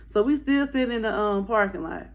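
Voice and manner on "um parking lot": ordinary delivery, nothing marked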